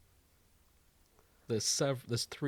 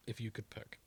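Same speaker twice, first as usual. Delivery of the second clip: whispered aside; 11 dB quieter than usual. Real, not acted. Whispered aside after question.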